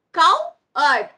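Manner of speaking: "Coward" in two loud beats, Cow - ard; broken into syllables. Shown as a demonstration. Teacher drilling children on syllables, split syllables. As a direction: separate both halves of "Coward". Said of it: "We break the words".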